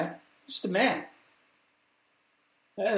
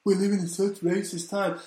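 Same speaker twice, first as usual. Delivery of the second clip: mocking whiny celebrity; 2 semitones higher than usual. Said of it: "all these idiots".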